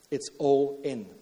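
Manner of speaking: slow, weighty delivery; slow emphatic. Acted. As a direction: lean on each word, slowly. Preacher repeats the key phrase.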